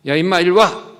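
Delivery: loud, high bossy call; bullying older kid. Acted. Older neighborhood boy loudly ordering the little boy over. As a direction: bark an order at the little one. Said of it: "그 형이".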